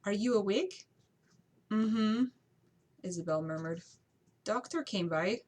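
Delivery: a failed, lightly voiced Spanish maid; attempted Spanish accent. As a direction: maid voice, meant to sound Spanish. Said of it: "That is not a Spanish accent, I'm sorry".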